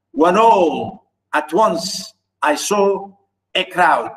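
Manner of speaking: slow "when ALL at ONCE I SAW a CROWD"; measured unstressed-stressed beat on "When all at once I saw a crowd"; iambic scansion. Shown as a demonstration. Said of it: "Unstressed stress".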